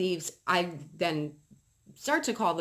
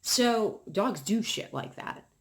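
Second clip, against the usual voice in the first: ordinary talk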